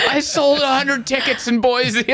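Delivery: high-pitched comic blubbering; hammed-up crying boast; mock sobbing. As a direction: wail it tearfully through laughter. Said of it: "I'm just sobbing".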